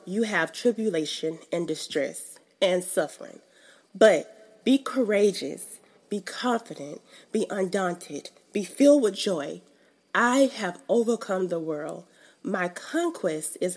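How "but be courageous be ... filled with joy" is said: each "be" phrase punched out; emphatic scripture reading. Shown as a demonstration. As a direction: read it with conviction. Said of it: "I had to put the emphasis on it".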